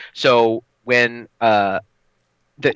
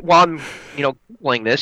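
normal talking voice

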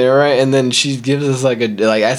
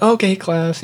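higher, drawn-up teacher call; imitated schoolteacher, pitched up; teacher addressing class; 10 semitones higher, slower than usual. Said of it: "she's like".

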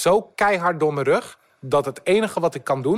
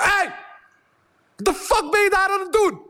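hoge, boze schreeuw; geschreeuwd; 14 semitones higher than usual. Retold or imitated. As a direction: schreeuw hem woedend toe. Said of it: "ik schreeuw naar die gast". (woedend schreeuwen naar een vreemde man)

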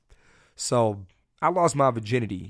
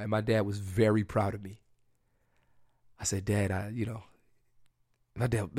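ordinary talk

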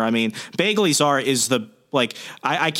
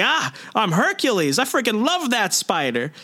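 much higher, gleeful mock boast; giddy Peter; 9 semitones higher than usual. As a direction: brag like a kid who found superpowers. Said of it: "he's like".

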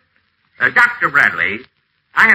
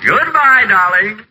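mock sweet goodbye; 8 semitones higher, 4 dB louder, much slower than usual. Fake sweet farewell to Maggie.

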